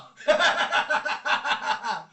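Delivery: big, loud, high belly laugh; loud laughter. Real, not acted. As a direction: burst out laughing hard. Cracks up at his own nickname.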